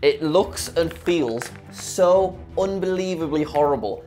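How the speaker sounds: normal talking voice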